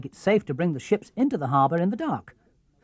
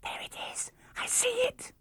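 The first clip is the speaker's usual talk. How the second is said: unvoiced whisper, rising sharply at the end; excited whisper; 6 dB quieter than usual. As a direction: whisper the find, barely holding back. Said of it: "There was a whisper from the lookout".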